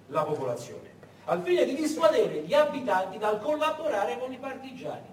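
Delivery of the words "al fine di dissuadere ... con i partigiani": ordinary talk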